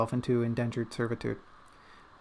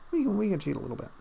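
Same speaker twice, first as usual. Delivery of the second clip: sly put-on voice; 7 semitones higher, much faster than usual. Conspiratorial put-on voice.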